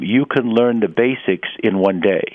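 usual speaking voice

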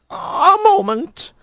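very high, slow; a prim lizard asking to wait. A narrator's reading. Lizard stalls.

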